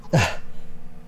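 short breathy sigh; frustrated sigh. Acted. Failing again at the greeting, frustrated sigh. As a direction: sigh softly after a failed attempt. Failed attempt at speaking.

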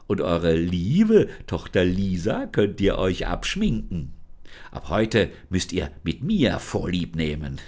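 a cold changeling laying down new rules; false daughter creature. Acted. Changeling taunts the parents who think she is Lisa, menacing.

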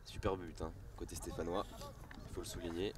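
ordinary talk, nothing marked

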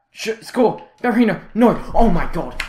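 high, very loud yells at the players; real agonized match shouting; frantic shouting. Real, not acted. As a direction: scream in disbelief as the chance goes. Shouts as shots hit post.

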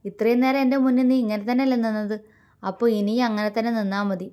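heated sarcastic tirade; angry. A narrator's reading. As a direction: let fly at her, exasperated. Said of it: "അവൻ ദേഷ്യത്തോടെ പറഞ്ഞു".

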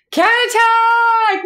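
long, high, loud drawn-out shout of a name; excited call-out. Real, not acted. Streamer hails a viewer, stretched excited shout.

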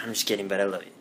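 usual speaking voice

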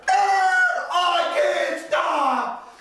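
high loud panic, drawn out; panicked scream. Acted. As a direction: scream as the brakes fail. Brakes fail.

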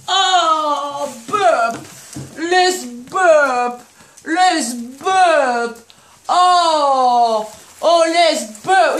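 loud, drawn-out coaxing; coaxing to burp. Real, not acted. Owner burping puppy Oscar after the meal, loud coaxing.

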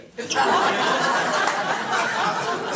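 loud, high laugh; real laugh at his own joke; burst of laughter. Real, not acted. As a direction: breaks up laughing.

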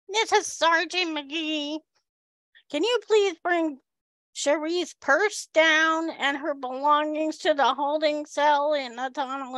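imitated cop in a high voice; high-voiced sergeant. Sergeant phones for the prisoner's purse, high mimicked voice. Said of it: "Is that really his voice?".

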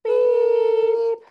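high loud timer beep; imitated beep. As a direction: mimic a shrill electronic beep. Imitating timer at balance end.

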